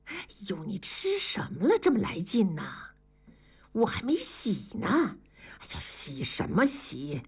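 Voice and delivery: lightly voiced wife, pushing him off; half-playful protest while wriggling free; wife fending off her husband at bedtime, mock-annoyed; struggling wife. A narrator's reading. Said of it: "文丽挣扎着说".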